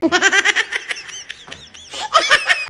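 a big high burst of laughter; sudden high giggling laugh; loud laugh. Real, not acted. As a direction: crack up at your own prank plan. Burst of laughter after plan.

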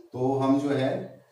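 ordinary delivery

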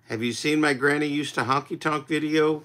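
usual speaking voice

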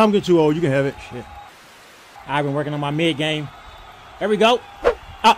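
usual speaking voice

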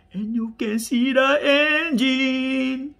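high, loud, stretched-out excitement; slow, high-pitched gushing; delighted showing-off. Real, not acted. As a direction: sing out the last word in delight. Showing off the engine.

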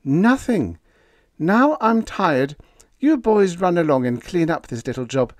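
high, loud, forceful wife's voice; a determined woman overruling her man; firm wife scolding her husband. A narrator's reading. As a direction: push him out the door to duty. Wife voiced sending men off.

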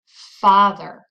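"Father" with a loud, strong first syllable; stress on first syllable. Shown as a demonstration. Teacher quizzing learners on stressed syllable, loud first syllable in "Father". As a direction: punch the "Fa" in "Father". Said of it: "it's the first syllable".

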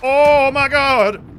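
drawn-out shocked oh my god; startled cry. Real, not acted. Gamer startled by an enemy, shocked cry. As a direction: genuinely caught off guard, slow and loud.

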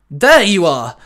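loud call on a rising-then-falling tune. Acted. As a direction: call out on finding someone. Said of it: "a voice called out".

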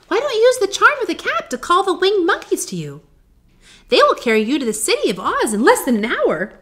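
high, loud mouse queen; squeaky little voice. A narrator's reading. Queen of the Mice advising lost Dorothy, squeaky. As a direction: pipe up like a small mouse. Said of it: "in her squeaky little voice".

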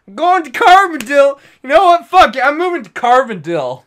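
loud, high, fake-decisive announcement; mock declaration. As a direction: declare it like a grand resolution.

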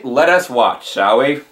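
ordinary talk

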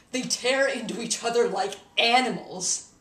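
loud, vehement disgust; disgusted outburst. Acted. Condemning people who tear each other apart, vehement disgust. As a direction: hit it hard, appalled at them.

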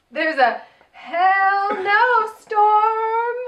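drawn-out, high sing-song mock weather line; mocking the drag queen's weather report; imitating India. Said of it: "I was laughing".

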